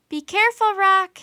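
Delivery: high, loud shouted warning. A narrator's reading. Annie calling after the flying raven, loud high shout. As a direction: call up after the bird. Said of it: "Annie shouted".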